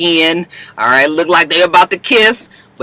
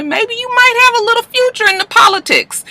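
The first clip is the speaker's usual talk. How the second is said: much higher, teasing voice; high teasing Cyrus impression; voicing Cyrus. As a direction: lightly play the flirty man.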